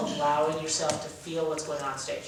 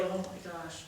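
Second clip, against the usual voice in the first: much softer, sinking mild sadness; teacher showing mild sadness; sad; 13 dB quieter, slower than usual. Shown as a demonstration. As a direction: let it drop quietly in sorrow. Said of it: "Sad ranges from literally".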